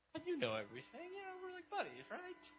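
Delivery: put-on high, soft, pleading childlike voice; sheepish Peter. Peter softly pleading with Jesus, high and small. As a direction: coax like an adult child asking forgiveness.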